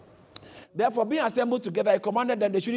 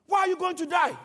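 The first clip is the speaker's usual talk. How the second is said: higher-pitched, indignant question; retold rebuke; 8 semitones higher than usual. Preacher voices Peter challenging Jesus, acted indignant rebuke. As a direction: question him in raised pitch, imitating a rebuke. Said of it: "took him and rebuked him".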